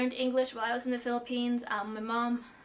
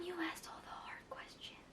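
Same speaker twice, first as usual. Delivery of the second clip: softer, breathless whisper; whispered complaint; 6 semitones higher, 15 dB quieter than usual. Real, not acted. Whispering to the asker that her questions are too hard. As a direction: hush it out like a mock groan to the asker.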